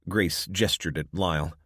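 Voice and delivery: ordinary delivery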